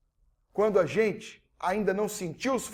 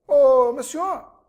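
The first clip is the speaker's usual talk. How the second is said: calling out; 5 semitones higher, 7 dB louder, much slower than usual. Retold or imitated. Visitor calls the old man.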